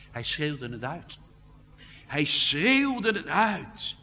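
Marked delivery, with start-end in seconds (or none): 2.1-4.0 hoog, luid en langzaam uitgeroepen. Shown as a demonstration. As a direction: roep het uit zoals Jezus riep. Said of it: "een woord dat letterlijk zegt". (de prediker doet Jezus' roep voor, luid en langzaam)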